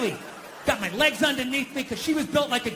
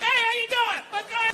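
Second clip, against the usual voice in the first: high, casual greeting in dad's voice; breezy dad; 11 semitones higher than usual. Retold or imitated. Dad greets the priest at mass, high breezy imitation. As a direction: imitate dad strolling up as if nothing happened. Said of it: "my dad just comes walking like".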